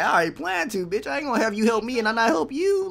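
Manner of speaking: high-pitched, rushed indignation; mock indignant retort. Reactor mimicking the player's refusal to help, indignantly. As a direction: voice his rattled, quick comeback.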